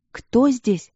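scared, shaky question; trembling voice. A narrator's reading. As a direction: ask it trembling with fear. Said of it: "спросила она дрожащим голосом".

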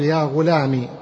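"غلامي" ending in short i; kasra ending. Shown as a demonstration. Teacher replacing ya with kasra, "غلامي" said ghulami. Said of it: "كسرة، تقول".

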